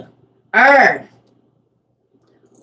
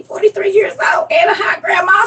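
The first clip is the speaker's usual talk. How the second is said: excited shout; 8 semitones higher than usual. Real, not acted. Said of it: "so I can do it".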